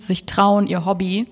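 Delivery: usual speaking voice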